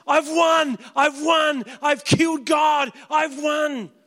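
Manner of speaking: loud, high gloating shout of a victor; gloating Satan shout. Acted. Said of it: "and Satan goes".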